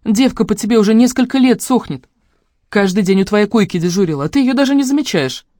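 high-pitched, emphatic reproach; exasperated scolding. A narrator's reading. Sister telling brother of his admirer, exasperated. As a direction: scold him for being blind.

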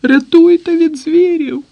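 набагато вищий, панічний зойк; кричи, благаючи про порятунок; вереск переляканого заручника. A narrator's reading. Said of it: "верещить Пустовоєв". (заручник верещить, кличе на допомогу)

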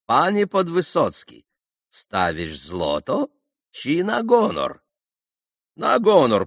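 high, loud hammed-up Polish banker; mock Polish banker. A narrator's reading. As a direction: ham up the pompous Polish dealer. Mitya voices banker and Podvysotsky. (пародия на польского банкира, высоко и громко)

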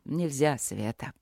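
low, soft, sorrowful refusal; sad. A narrator's reading. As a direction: let it sink low and quiet on her name. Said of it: "печально объяснил мастер".

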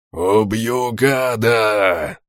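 slow drawn-out furious yell; battle cry. Acted. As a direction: roar it as you charge. Boarding fight, battle cry.

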